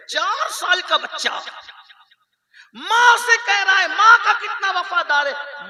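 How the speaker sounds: building into loud, high, impassioned preaching; fiery preaching. Acted. Preacher rousing the women of the congregation, loud fiery preaching. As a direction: thunder the rebuke at the congregation.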